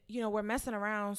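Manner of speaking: usual speaking voice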